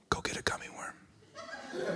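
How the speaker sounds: whispered fatherly reply; mock solemn whisper. Father granting his daughter a gummy worm, mock solemn whisper. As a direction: barely breathe it out, slowly.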